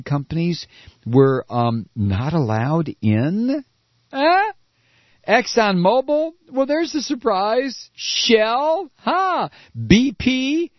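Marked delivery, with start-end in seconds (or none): 4.1-4.5 a much higher, louder mock "huh"; mock surprised. Sarcastic reader mocking the news, in mock surprise. 5.3-10.7 much higher voice, fake amazement at each name; sarcastic mock surprise. Commentator mocking oil company names, sarcastic.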